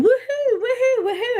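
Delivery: high-pitched mock woohoos; singsong imitation whoops, way up high; imitated cheering. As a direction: mimic revellers whooping on the bed. Retold cheering of women on bed.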